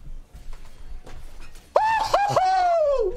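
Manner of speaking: high shrieking laugh of triumph; wild victory shriek-laugh. Real, not acted. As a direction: burst into a screaming laugh. Big win moment.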